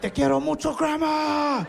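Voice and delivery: high, loud yelled Spanish with a laugh; desperate cry. Retold or imitated. Fighter drawing strength from his family cries out to Grandma. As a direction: scream your love to Grandma for strength.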